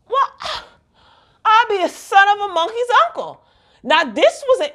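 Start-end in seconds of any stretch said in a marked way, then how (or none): 0.1-3.4 high put-on voice, words stretched; mock old-timey exclamation. Reacting to the plot twist, high put-on mock voice. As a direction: gasp it out like a flustered old lady.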